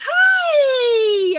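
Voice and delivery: very high, loud, stretched-out "hi"; imitated shouted greeting. Lover answering the crash call, exuberant shout. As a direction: holler it long and high, oblivious and cheery. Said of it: "He goes".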